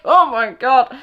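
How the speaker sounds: loud, high mock-shocked exclamation; mock astonishment. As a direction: imitate his flustered disbelief, loudly. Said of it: "he's like".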